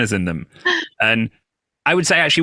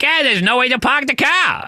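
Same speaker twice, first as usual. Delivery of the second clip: higher imitation of a Boston driver, car as cah; Boston accent; 13 semitones higher than usual. Boston driver complaining about construction, thick Boston accent. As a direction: do the Boston cabbie, park the cah. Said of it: "He's like".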